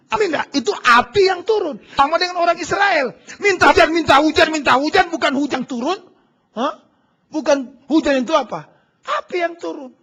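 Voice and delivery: loud, high preaching voice driving the point home; full-force preaching. Acted. Pastor to congregation, a fiery loud preaching call. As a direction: call it out to the whole church.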